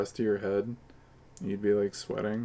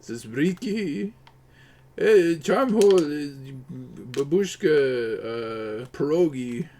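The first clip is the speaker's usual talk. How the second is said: put-on a clumsy stab at a Polish accent; mock Polish accent with Polish words; fake Polish accent; 2 semitones higher, slower than usual. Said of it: "what's a Polish accent".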